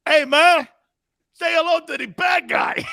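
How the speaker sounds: loud, high mock Cuban accent; Pacino, bad Cuban accent. Host mocks Pacino playing a Cuban in Scarface, exaggerated Cuban accent. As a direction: ham up a fake Cuban movie gangster. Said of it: "Pacino playing like a Cuban guy".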